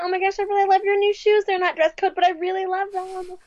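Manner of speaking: high, quick, gushing teacher voice; super perky. Acted. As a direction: gush as an over-perky teacher. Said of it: "super perky".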